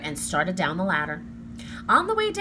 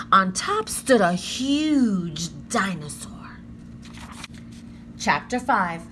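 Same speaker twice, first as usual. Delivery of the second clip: ordinary delivery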